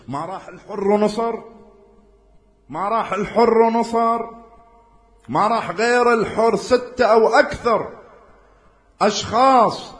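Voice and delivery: high, loud and slow sermon build; climactic preaching. Acted. Preacher stressing how few joined Hussain, high and loud. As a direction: swell it toward the peak.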